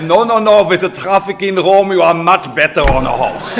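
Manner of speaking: put-on booming German-accented cardinal, laughing through the end; playful imitation in a thick German accent; Cardinal Kasper's German accent. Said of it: "tell you what he said with a heavy German accent".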